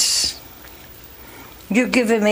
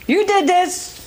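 higher-pitched imitation of an accusing wife; mock blaming; 8 semitones higher than usual. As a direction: act out blaming the husband, lightly. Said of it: "She blames him".

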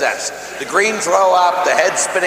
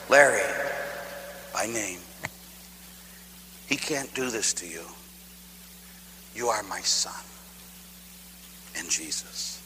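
much softer, lower, gentle voice of God; quiet voice of God; 7 semitones lower, 12 dB quieter than usual. Retold or imitated. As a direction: speak as the Father, hushed and tender. Voice of God in dream.

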